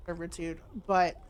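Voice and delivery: ordinary talk, nothing marked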